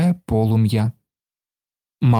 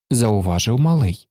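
ordinary delivery, nothing marked

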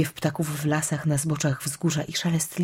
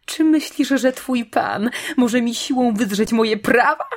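high, loud outraged question; indignant witch; 6 semitones higher, 5 dB louder than usual. A narrator's reading. Witch defying the bull over her rights, indignant. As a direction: fling the challenge out shrilly.

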